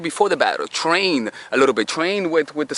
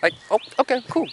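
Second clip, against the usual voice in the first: playful; 6 semitones higher than usual. Pretend. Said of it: "you react in a playful way".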